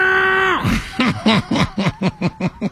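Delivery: laughing shout; high laugh. Real, not acted. Laughs at own joke.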